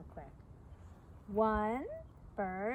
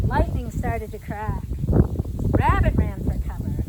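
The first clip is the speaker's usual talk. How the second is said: booming storyteller lines, louder for the lightning; dramatic loud; 11 dB louder, slower than usual. A narrator's reading. Telling kids the lightning cracked, loud dramatic read.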